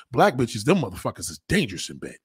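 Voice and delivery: normal talking voice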